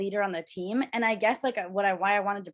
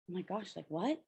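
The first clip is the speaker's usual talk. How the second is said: stunned hushed reaction; 2 semitones lower, 11 dB quieter than usual. Retold or imitated. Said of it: "And I was like".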